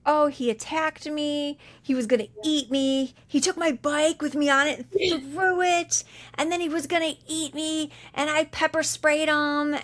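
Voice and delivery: high, whiny mock victim voice; hammed-up frightened man retelling his attack. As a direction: play the panicked man, high and frantic. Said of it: "He says, like".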